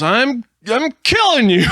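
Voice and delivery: high, loud, laughing menace; gleeful killer. Pretend. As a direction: announce it with a giggle. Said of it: "He goes".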